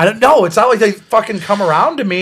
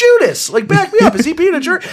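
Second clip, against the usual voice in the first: Peter; 13 semitones higher than usual. Acted. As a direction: appeal to a friend in a hammed-up high voice. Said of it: "Peter's just like".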